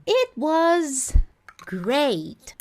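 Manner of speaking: "It was" high, then "great" falling; demo phrase. Shown as a demonstration. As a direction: lift "It was", drop on "great". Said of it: "ela foi de tal forma".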